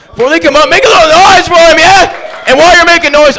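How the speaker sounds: loud, high-pitched emcee call; rally the room to cheer; excited shout to the audience; hyping the crowd. Real, not acted. Host whipping up the crowd.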